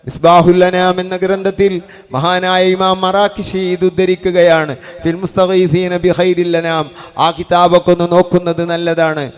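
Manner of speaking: fiery preaching. Acted. Preacher citing a book to his brothers, loud fervent preaching. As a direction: call it out with preacher's force.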